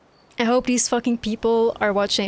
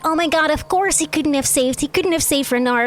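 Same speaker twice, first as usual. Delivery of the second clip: mocking chat voice; 6 semitones higher, 4 dB louder than usual. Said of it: "they were like".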